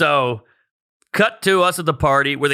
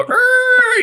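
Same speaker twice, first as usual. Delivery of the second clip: high, loud revving engine sound; engine noise; 6 dB louder than usual. Retold or imitated. Telling a friend about the getaway drive, revving engine noise. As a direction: mimic the car's screech as you swerve. Imitating car swerving through traffic.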